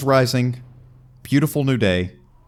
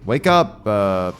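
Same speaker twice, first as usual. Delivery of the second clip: mock prophet voice; 3 semitones higher, 4 dB louder, much slower than usual. Joking parody of the bit.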